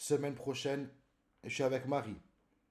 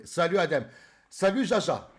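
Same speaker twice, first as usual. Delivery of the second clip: loud, raised-pitch hello; called-out greeting; 4 semitones higher, 8 dB louder than usual. Real, not acted. Streamer greeting viewers by name, calling out. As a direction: hail each one loudly.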